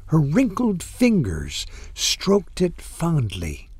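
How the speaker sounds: ordinary delivery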